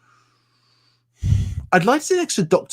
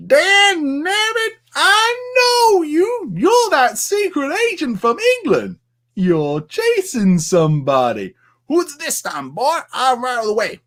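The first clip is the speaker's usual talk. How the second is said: high, slow drawling Southern sheriff; Sheriff Pepper impression; 10 semitones higher, slower than usual. Streamer doing Sheriff Pepper for his viewers. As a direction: ham up the pushy small-town sheriff. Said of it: "Sheriff Pepper".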